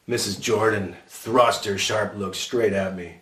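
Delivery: ordinary delivery, nothing marked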